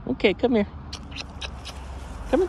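high, loud call to a dog. Real, not acted. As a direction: call the dog over from across the yard. Calling the dog from afar.

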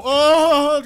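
drawn-out loud "oh", pitched high; loud startled cry. Real, not acted. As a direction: cry out in alarm. Startled while sneaking off in game.

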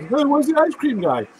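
lightly voiced eager coworker asking; eager coworker again. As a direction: mimic him asking hopefully. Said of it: "he goes".

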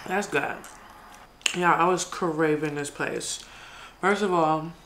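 talking while chewing; speaking between bites of pasta; mouth full. Real, not acted. Seen 1: Forkful of pasta taken at start, then chewing while talking.

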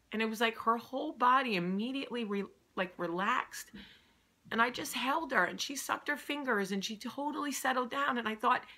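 ordinary delivery

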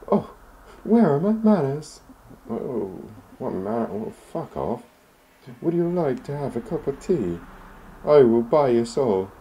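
very high, loud granny voice; sweet old lady. Acted. Landlady offering tea to the visitor, high and sweet.

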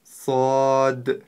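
Сад with a hard whistling s and a clipped final д; Arabic letter sad. Shown as a demonstration. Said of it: "Послушайте внимательно".

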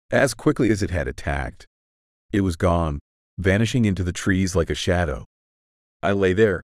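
ordinary delivery

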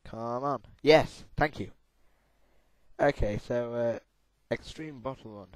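normal talking voice

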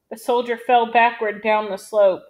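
plain reading voice

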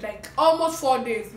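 normal talking voice

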